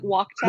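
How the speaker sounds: "Wachtel" as WAHK-tel; demonstrated pronunciation. Shown as a demonstration. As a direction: say "Wachtel" with a hard K.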